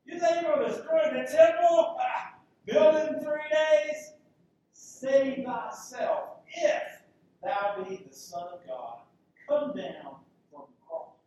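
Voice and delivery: loud, high jeering taunt; passers-by taunting Jesus, loud scornful mockery; mocking voice. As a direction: sneer it at the man on the cross. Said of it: "This is all in a mocking voice".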